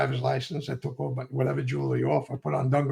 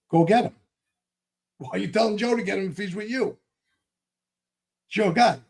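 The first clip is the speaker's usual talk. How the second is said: rising, louder indignant push back; sharp retold argument; heated retort; 6 semitones higher, 4 dB louder than usual. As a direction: throw the question back at him, heated.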